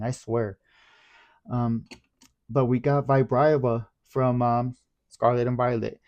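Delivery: ordinary talk, nothing marked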